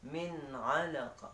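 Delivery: ordinary talk, nothing marked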